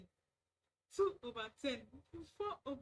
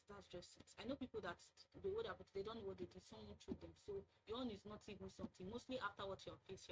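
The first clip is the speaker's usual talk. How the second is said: normal talking voice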